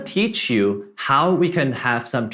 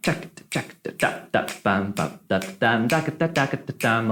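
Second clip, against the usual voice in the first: percussive "check, da, bum" syllables; beatbox the melody's shifting duple and triple pattern; vocalized rhythm; 2 semitones lower than usual. Shown as a demonstration. Teacher shows duple against triple time, vocalized rhythm. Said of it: "interplay between duple and triple time".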